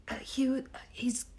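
David Attenborough. Acted. Guest tries narrating a bird video, hushed British narrator. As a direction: whisper it like a wildlife documentary. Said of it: "please be our David Attenborough and narrate".

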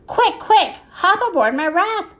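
high, urgent storybook call; urgent urging. A narrator's reading. Ziggy to the fleeing pigs, urgently urging. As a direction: hurry them along, pitch well up.

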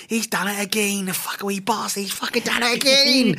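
high, exasperated mock wail; imitating annoyed old fan. Old fan ranting at Harry Kane scoring, a high mock wail. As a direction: take off the old man raging at the telly, high up. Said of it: "there's a video clip of him where he's going".

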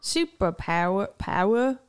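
Australian vowels, dropped r; Australian accent. Shown as a demonstration. Host drills her learning word, Australian accent. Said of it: "but with an Australian accent".

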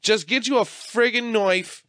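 put-on high, slow, overdone Boston accent; a clumsy Boston accent impression; bad Boston accent. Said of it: "That was a bad Os-, Boston accent".

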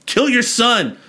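very high, emphatic mock exasperation; high-pitched insistence, falling hard at the end; insistent repeat. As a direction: repeat the order as if to someone slow. God repeats the order insistently.

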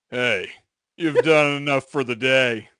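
lightly voiced Blake, the boss; a hint of a gruff cartoon dad; Blake's voice. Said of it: "I get to do voices now".

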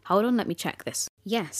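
usual speaking voice

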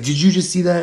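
high rattled echo of the question; brother's echoing yell. Retold or imitated. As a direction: throw it straight back, rattled. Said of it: "we're, like, yelling".

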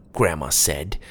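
usual speaking voice

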